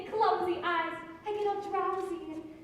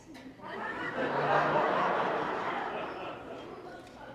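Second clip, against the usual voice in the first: giggle. Acted. Giggles at the news.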